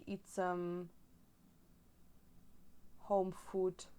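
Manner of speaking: ordinary talk, nothing marked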